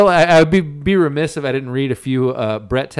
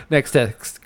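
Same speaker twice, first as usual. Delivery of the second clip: ordinary delivery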